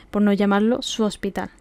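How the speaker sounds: ordinary delivery, nothing marked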